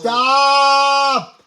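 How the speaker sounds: loud, angry shout; shouting stop. Real, not acted. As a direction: yell it to make him quit. Child shouting at teasing dad.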